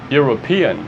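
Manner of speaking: European started with a Y sound; English pronunciation. Shown as a demonstration. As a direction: glide into European with Y. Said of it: "Esse som aqui é European". (European pronunciado com Y no começo)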